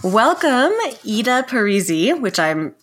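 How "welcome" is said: normal talking voice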